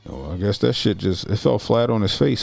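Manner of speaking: normal talking voice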